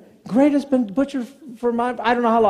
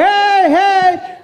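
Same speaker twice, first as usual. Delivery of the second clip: high, drawn-out holler; retold shout to get attention; 9 semitones higher, 4 dB louder than usual. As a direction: holler down the aisle after someone. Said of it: "so I'm hauling".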